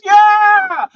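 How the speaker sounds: very high, loud cheer; thrilled kid. Retold or imitated. Stoked shriek. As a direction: son cheering at two giant chickens, high and loud. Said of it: "And he goes".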